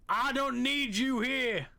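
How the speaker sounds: high, slow mock-angry declaration; mock outburst. Mock outburst at viewers, then joking.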